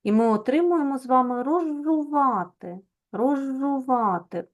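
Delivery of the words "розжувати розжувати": slow «розжувати» with a long, held ж sound; doubled consonant. Shown as a demonstration. Teacher shows pupils the doubling in «розжувати», drawn-out consonant. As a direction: stretch the doubled ж in «розжувати», slowly. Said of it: "Ось показуємо подвоєння".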